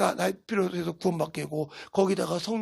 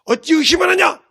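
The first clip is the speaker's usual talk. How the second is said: loud, high-pitched rebuke; rebuking shout; 7 semitones higher, 7 dB louder than usual. Acted. Preacher voices Jesus scolding his disciples, rebuking shout. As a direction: raise the voice sharply in reproach. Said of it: "내 자식, 내 제자들 보고".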